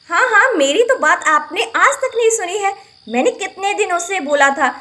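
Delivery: normal talking voice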